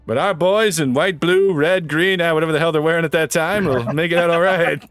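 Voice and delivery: proud wartime broadcast voice; newscaster voice. Acted. Joins in newsreel parody.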